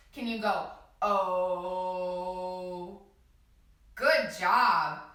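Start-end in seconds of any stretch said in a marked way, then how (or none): 1.0-3.0 a long steady low "Oh" held out. Shown as a demonstration. Teacher leading children in the universe sound, long low oh. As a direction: hum an open "Oh" like the universe's heartbeat. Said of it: "making an O with our mouths".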